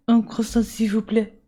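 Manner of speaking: put-on careful French accent on the order; attempted French. Tourist ordering at a bakery, careful French. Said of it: "in proper French".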